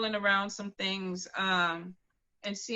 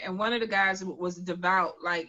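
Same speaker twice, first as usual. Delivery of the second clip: ordinary talk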